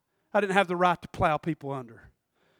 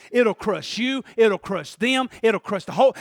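ordinary talk, nothing marked

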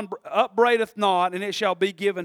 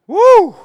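high, loud "woo"; short exultant holler; praise whoop; 11 semitones higher, 13 dB louder than usual. Real, not acted.

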